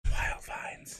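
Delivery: hushed, voiceless whisper; mock-dramatic whisper. Host repeating the brand name after the flavors, whispered. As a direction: whisper it like a secret product reveal.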